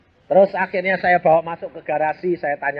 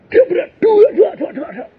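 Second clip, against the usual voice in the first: crash sound effect; 5 dB louder than usual. Retold or imitated. Imitating crash noises.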